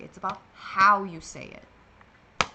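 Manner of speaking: sassy. Shown as a demonstration. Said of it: "I don't know why I have to be like".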